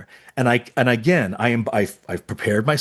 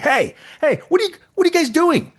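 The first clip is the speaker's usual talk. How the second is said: scolding audition runner. Retold or imitated. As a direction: break up the noise, sharply.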